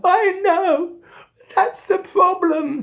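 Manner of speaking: high, loud cartoon wail; crying. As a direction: bawl it like a hopeless cat. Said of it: "Choking on his crying".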